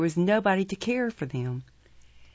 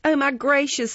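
high, louder exclamation of distress; loud lament; 8 semitones higher, 5 dB louder than usual. A narrator's reading. Young woman embracing Oliver, loudly lamenting. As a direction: lament aloud, theatrically. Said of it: "a great number of loud lamentations".

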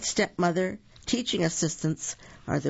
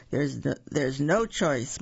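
ordinary delivery

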